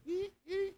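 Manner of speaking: soft, high "ee" squeaks; thin, high creaking noise; imitated squeaky swinging. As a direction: squeak quietly like a swinging taillight. Imitates the taillight still swinging.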